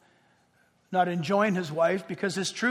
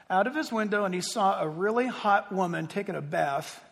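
ordinary talk

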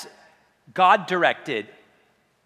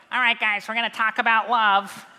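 higher, imitating a squeaky preacher; Paul's high-pitched voice; 5 semitones higher than usual. Preacher pictures Paul addressing the church in a higher, mock-squeaky voice. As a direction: play a reedy little apostle greeting the crowd. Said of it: "and a high-pitched voice".

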